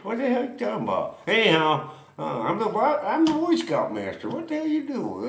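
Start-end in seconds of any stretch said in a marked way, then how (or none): 0.0-1.2 a fast, high, retold protest; mock indignant. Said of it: "I said". 2.4-5.3 high, half-hearted indignant protest; mock outrage. Retold argument with general.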